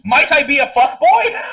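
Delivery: loud, laughing mock alarm; laughing shout. Real, not acted. Joking self-accusation, laughing.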